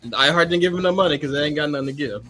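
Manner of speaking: ordinary delivery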